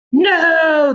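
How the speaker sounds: a man crying out in terror; cried out. Acted. Man in danger cries out, loud high cry. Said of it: "the man cried".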